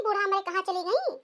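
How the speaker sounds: ordinary talk, nothing marked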